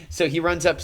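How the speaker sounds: usual speaking voice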